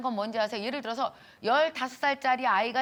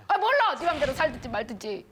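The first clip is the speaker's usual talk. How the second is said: loud, high "I don't care" brush-off; dismissive mother; 4 semitones higher, 4 dB louder than usual. Retold or imitated. Mother dismissing her divorced daughter, loud and high. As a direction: throw up your hands at the daughter. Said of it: "엄마가 뭐라 그래요?".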